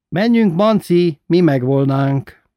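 loud, high, drawn-out call. A narrator's reading. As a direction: call into the next room that it's time to go. Calling a companion to leave.

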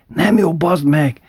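high, exasperated corrections; primás scolding father for wrong chords; scolding bandleader. Retold or imitated. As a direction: swear at the pianist mid-song. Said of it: "A primás előre sukta".

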